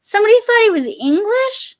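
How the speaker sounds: high, loud disbelief; real surprise, voice shooting up; astonished question. Real, not acted. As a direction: ask it loudly, incredulous. Astonished at the story just told.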